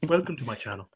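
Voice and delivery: gentle, hushed review intro as Todd; soft-spoken reviewer intro; Todd impression. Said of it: "I love watching Todd".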